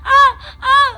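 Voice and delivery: sudden high cries of fear; frightened gasp. Real, not acted. Participant alone in haunted villa gasps in fear. Terrified participant sees ghost.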